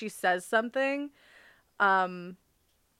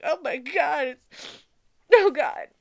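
higher, mildly choked-up exclamation; overcome with emotion; 6 semitones higher, 6 dB louder than usual. Retold or imitated. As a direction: act out being moved by the scene. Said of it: "I just get so emotional".

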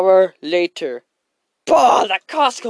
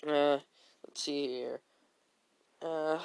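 ordinary talk

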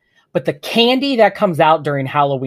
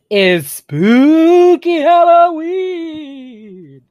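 high, slow, drawn-out spooky ghost voice; 12 semitones higher, 5 dB louder, much slower than usual. Acted. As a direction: stretch it out like a hammed-up ghost. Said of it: "That's my attempt".

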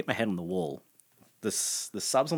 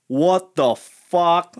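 shouting; 9 semitones higher, 12 dB louder, much slower than usual. Retold or imitated. Everyone on court reacting.

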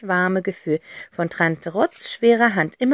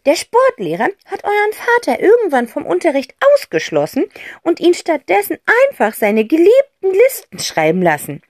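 higher, listing with relish; lively aunt; 7 semitones higher, 5 dB louder than usual. Acted. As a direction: reel off the list like a gleeful aunt. Aunt tells the story. (Tante Rotz zählt vergnügt auf)

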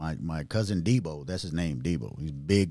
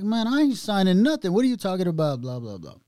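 much higher and louder, yelling down the phone; retold angry yell; 11 semitones higher, 6 dB louder than usual. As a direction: shout him off the line.

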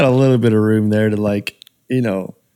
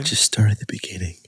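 whispered prompt; 3 semitones lower, 6 dB quieter, faster than usual. Real, not acted. Co-host prompting quietly.

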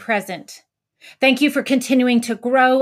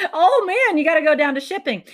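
much higher, quick and eager; excited; 7 semitones higher, faster than usual. Retold or imitated. Worker urging Chris down to shipping, excited. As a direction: blurt it out with glee.

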